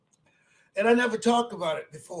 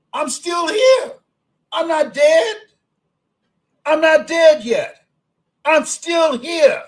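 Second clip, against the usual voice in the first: loud, higher, slow and emphatic; defiant declaration; 6 semitones higher, 7 dB louder, slower than usual. Real, not acted. Old speaker defying those who count him out, slow loud declaration. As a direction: declare it like a battle cry.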